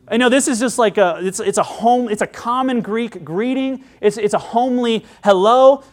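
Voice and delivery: ordinary talk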